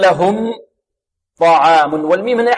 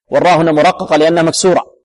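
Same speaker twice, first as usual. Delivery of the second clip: ordinary talk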